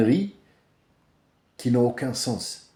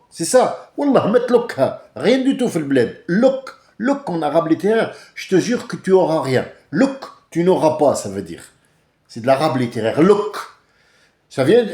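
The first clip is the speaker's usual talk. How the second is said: higher, louder outraged tirade; indignant rant; 4 semitones higher, 6 dB louder than usual. Pretend. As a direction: build to a furious outburst. Heated rant at the passenger. (tirade indignée, plus aiguë et plus forte)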